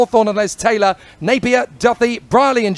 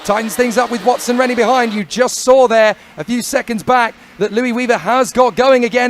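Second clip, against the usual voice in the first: ordinary delivery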